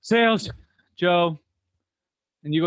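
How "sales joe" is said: two loud, separated barks; yelled phone greeting. Retold or imitated. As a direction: imitate a salesman yelling department, then name. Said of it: "that yells their department, then their name".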